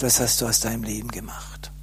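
hushed whisper of a voice inside him; soft, breathless whispered reproach; inner voice whispering. Retold or imitated. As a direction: whisper the question like a voice from within. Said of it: "hörte ich plötzlich eine innere Stimme".

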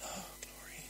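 breathless awed whisper. Acted. Preacher showing God's gentle whisper, whispered. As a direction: whisper it like a secret of glory. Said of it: "mit einem wunderbaren Flüstern".

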